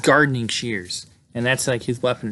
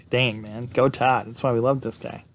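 ordinary talk, nothing marked

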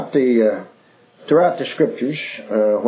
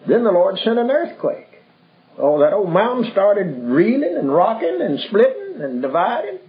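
excited telling; 8 semitones higher than usual. A narrator's reading. Preacher retelling Elijah's earthquake to his listeners, excited. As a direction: pitch climbing higher as the mountain shakes.

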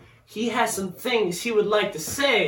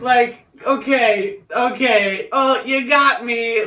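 slow, loud, high sarcastic concession; drawn-out mock defeat; mock surrender; 5 semitones higher, 5 dB louder, much slower than usual. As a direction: give in with exaggerated sarcasm. Sarcastic surrender to a bad comeback.

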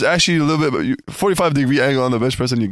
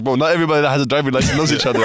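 fast laughing retort; much faster than usual. Retold or imitated. Friend's retort retold.

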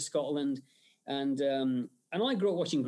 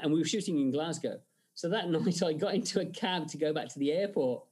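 ordinary talk

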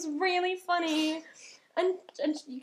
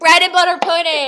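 loud, higher insistence; shouted correction; 4 semitones higher, 12 dB louder than usual. Real, not acted. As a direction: drown out the friend with the correction. Shouts correction over friend's soggy bread.

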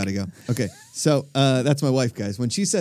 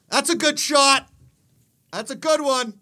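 high-pitched call from the sidelines; mock cheering yell; 11 semitones higher than usual. Husband shows wife how to cheer at golf, mock yell. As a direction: call it out high, without much extra volume. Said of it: "You can yell stuff like that".

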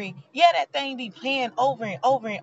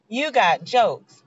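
slow, drawn-out repeat; wry repeat; much slower than usual. Real, not acted. Repeating the joke line to viewers, slow and amused. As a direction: lean on it slowly, amused. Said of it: "repeat of the punchline".

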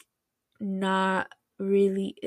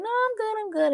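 imitated breezy refusal, pitched way up; high-pitched brush-off; 12 semitones higher than usual. He turns down her offer of help, high-pitched brush-off.

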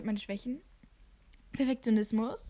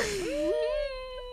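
squealing awkward laugh; 13 semitones higher than usual. Retold or imitated.

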